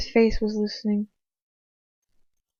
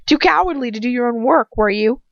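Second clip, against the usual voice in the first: louder, accusing and raw; voice rising with emotion; 3 semitones higher, 7 dB louder than usual. A narrator's reading. Girl accuses her captor in a rising, emotional voice. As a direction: let the voice rise and crack. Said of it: "my voice rising and crackling with emotion".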